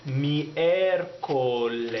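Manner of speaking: very slow "miércoles", each syllable clear; slow model word. Shown as a demonstration. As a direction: stretch "miércoles" out for learners. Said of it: "Wednesday in Spanish is".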